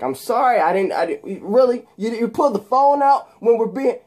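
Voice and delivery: high flustered excuse; high loud flustered protest; flustered apology. Retold or imitated. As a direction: stammer it out high and defensive.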